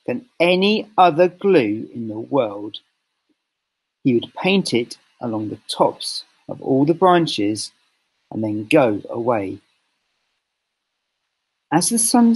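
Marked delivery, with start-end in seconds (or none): none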